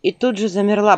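ordinary delivery, nothing marked

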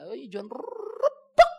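ordinary delivery

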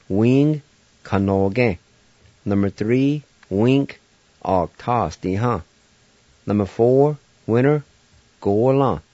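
each Cherokee word said whole after its English, Tsolane, Kanoge, Octosti, golan, uyana. Shown as a demonstration. Teacher reviewing five Cherokee words at normal pace. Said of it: "pronounce them one more time".

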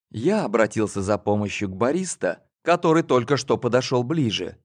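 plain reading voice

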